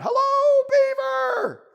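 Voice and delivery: very high, loud bunny call; hollering out. A narrator's reading. Bunny calls to beaver's house in lake, hollering. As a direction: holler it across the water. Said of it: "Barrington hollers out".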